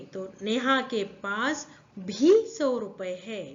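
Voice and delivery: भी held and leaned on; teacher stressing भी. Shown as a demonstration. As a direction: lean on भी for the class. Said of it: "भी के ऊपर जोर आता है बच्चों".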